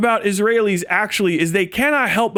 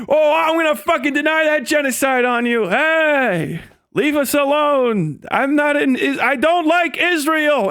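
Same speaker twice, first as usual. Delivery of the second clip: higher, put-on sneering voice; a mocking caricature voice, raised pitch; mock troll voice; 5 semitones higher than usual. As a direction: parody an online troll getting defensive. Mocking online antisemite's voice.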